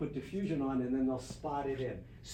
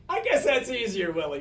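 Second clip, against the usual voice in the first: high, loud amused reply; amused director; 7 semitones higher, 8 dB louder than usual. Retold or imitated. Director laughs off the gaffer's outburst, amused reply. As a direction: toss it back with a grin. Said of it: "He goes".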